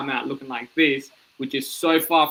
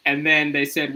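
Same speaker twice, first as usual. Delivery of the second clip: ordinary talk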